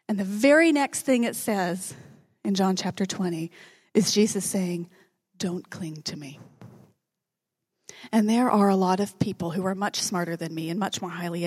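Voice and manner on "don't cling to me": a hushed, grave command; slow whisper. Acted. Jesus telling Mary at the tomb not to cling, whispered. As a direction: whisper it slowly, low and tender. Jesus's words quoted from scripture.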